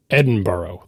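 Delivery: Edinburgh ending in a full "burrow"; American mispronunciation. Shown as a demonstration. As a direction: say Edinburgh with a long final "oh", like borough. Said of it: "pronunciations that a Scottish person will tell you is just wrong".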